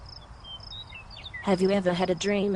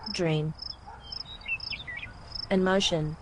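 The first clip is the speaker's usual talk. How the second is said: Australian accent word list; 7 dB quieter, slower than usual. Shown as a demonstration. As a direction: read the words in an Australian accent. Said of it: "say the words for us with your Australian accent".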